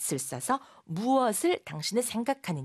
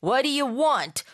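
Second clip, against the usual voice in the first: higher, louder confrontational question; unsmiling demand; 6 semitones higher, 6 dB louder, much slower than usual. Shown as a demonstration. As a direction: demand it like a movie tough, no smile. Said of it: "방실방실 웃으면서 하는 말 같진 않죠".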